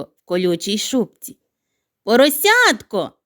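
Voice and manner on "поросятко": high loud call. Acted. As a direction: sing out the name across the yard. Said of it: "гукнула вона".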